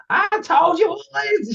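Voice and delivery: very high, slow furious yell; livid shout. Retold or imitated. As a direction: storm over and scold the class. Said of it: "she literally storms over".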